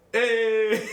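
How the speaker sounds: loud cheerful hey out the car window; friendly shout. Retold or imitated. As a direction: call out to a friend brightly. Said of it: "I put down the window and I go".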